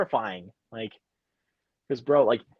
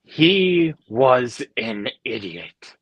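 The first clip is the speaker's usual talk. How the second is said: slow dismissive line; much slower than usual. Retold or imitated. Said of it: "in a menacing voice".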